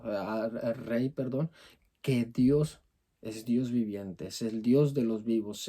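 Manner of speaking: usual speaking voice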